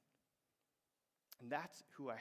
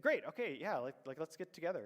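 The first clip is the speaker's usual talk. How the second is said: eager yes; 3 semitones higher, 7 dB louder than usual. Retold or imitated. Friend eagerly agrees.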